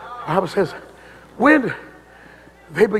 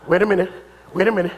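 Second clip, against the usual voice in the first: ordinary talk, nothing marked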